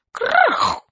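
sharp high crash noise voiced out; crash sound effect. A narrator's reading. Narrator makes the crash sound for children. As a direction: blurt the crash like a breaking thing.

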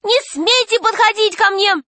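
high-pitched, forceful warning off; frightened girl's cry. A narrator's reading. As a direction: cry out to keep someone away. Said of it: "закричала вдруг Алиса".

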